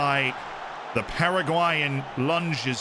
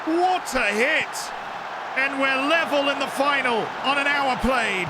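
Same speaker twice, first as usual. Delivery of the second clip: goal call shout; 10 semitones higher than usual. Real, not acted. Streamer calling the equalizer, long ecstatic shout. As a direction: drag out the scorer's name in joy.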